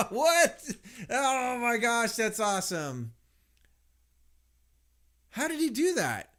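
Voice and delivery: high, loud, thrilled exclamations; amazed. Real, not acted. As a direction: gush with disbelief. Amazed at game visuals.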